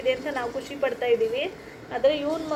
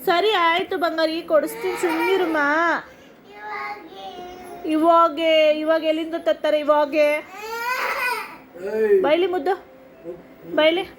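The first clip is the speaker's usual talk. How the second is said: comforting son; 4 semitones higher than usual. Real, not acted. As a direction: promise him tenderly to stop the tears.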